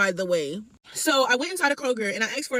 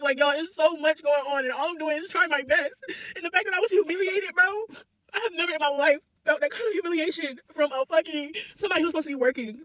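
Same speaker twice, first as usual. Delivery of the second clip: fighting back sobs while venting; crying; 3 semitones higher than usual. Real, not acted. Customer telling followers how humiliated she felt, crying. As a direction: voice breaking high through real tears. Tearful rant about humiliation.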